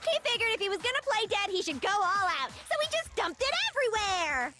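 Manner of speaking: fast, high excited rant. Acted. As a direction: read it all worked up. Friend reads Hina's lines excitedly.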